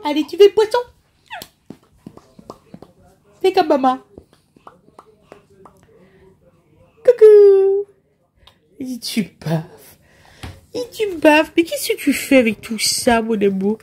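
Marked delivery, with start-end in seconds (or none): 0.0-7.9 put-on high singsong cooing to a baby; cooing high to a six-month-old; baby talk. Mother coos to her baby. 8.8-9.6 unvoiced whisper to the baby; whispered teasing to a baby. Repeats line to baby, whispered.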